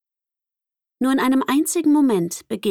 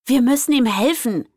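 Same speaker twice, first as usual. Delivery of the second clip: higher, louder urgent call; 6 semitones higher, 4 dB louder than usual. A narrator's reading. Lizzie calls to the group to help Vincent, urgent shout. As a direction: urge the others to help him. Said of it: "rief Lizzie".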